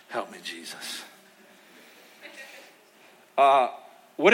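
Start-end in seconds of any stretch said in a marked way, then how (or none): 0.1-1.0 very soft, low sighed prayer; sighing plea. Real, not acted. Pastor out of time, sighing to Jesus. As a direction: breathe it out as a weary aside. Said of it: "sighs, out of time".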